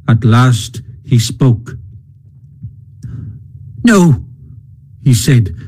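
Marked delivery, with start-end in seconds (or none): 3.8-4.3 higher, stubborn refusal; sullen but stout. A narrator's reading. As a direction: refuse stoutly, a little sulky. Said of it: "a little sullenly but stoutly".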